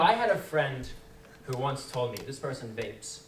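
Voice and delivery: ordinary talk, nothing marked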